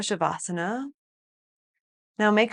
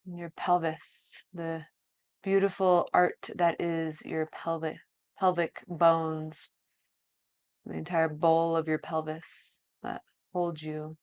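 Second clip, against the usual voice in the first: softer, slow body-scan guidance; guided meditation; 8 dB quieter than usual. Acted. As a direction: lead a calm body scan.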